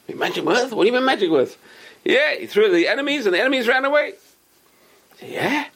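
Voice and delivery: high, astonished questions; amazed crooks. Acted. Hotel crooks hearing about the magic earth, amazed. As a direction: marvel at the tale.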